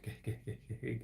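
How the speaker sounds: normal talking voice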